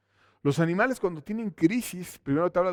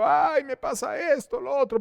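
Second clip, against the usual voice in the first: higher mock whine of a complainer; mock whining; 7 semitones higher than usual. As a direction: parody a self-pitying manipulator. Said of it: "están llorando y diciendo".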